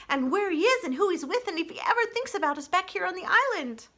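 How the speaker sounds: high, bright-eyed little chatterbox; high, wistful young critter; chatty young opossum. Acted. Chit-Chat character voice.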